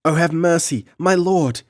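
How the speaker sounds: very high, loud terrified scream; child screaming at the whipping count; screaming plea. Acted. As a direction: scream for mercy in pain. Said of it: "screamed the child".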